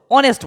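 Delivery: অনেস্ট with the h silent; correct pronunciation. Shown as a demonstration. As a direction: start অনেস্ট cleanly on o. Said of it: "ও উচ্চারণ হইতেছে".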